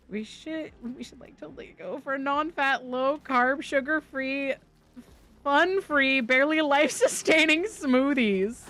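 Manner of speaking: put-on high-pitched Valley Girl drawl. Streamer imitating friend's Valley Girl bit about smoothies, Valley Girl accent. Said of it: "doing a Valley Girl accent".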